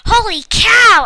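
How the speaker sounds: high loud excited scream; full-voiced surprised scream. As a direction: shriek it out in delight. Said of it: "I screamed".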